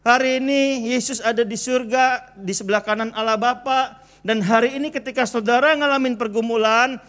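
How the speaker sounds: ordinary delivery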